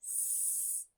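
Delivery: hissed S, drawn out; isolated S sound. Shown as a demonstration. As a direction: hiss the S alone, no voice. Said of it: "One of my favorite S words".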